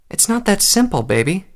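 high voice dropping on the endearment; gentle mother. A narrator's reading. Mother explains to her pouting filly, gently. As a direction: let it down gently for a little child.

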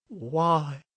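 high, drawn-out, lost question; stunned disbelief. A narrator's reading. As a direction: ask it as if the ground fell away. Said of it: "looked down to find that there was no ground".

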